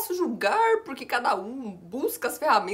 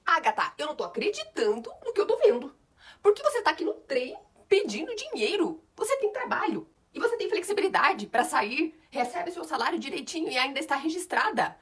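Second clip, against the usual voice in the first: outraged boss; 6 semitones higher than usual. Acted. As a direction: scold them in disbelief. Boss scolding employees.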